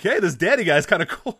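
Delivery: amused imitation of the clueless friend; laughing imitation. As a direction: chuckle through the friend's words.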